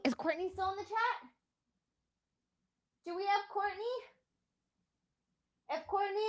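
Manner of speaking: soft question. Real, not acted. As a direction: ask quietly. Asking chat, soft and high.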